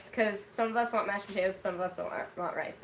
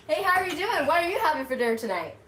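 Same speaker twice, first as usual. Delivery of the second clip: cheery greeting; 7 semitones higher, 5 dB louder, faster than usual. Real, not acted. Cheery greeting to live viewers.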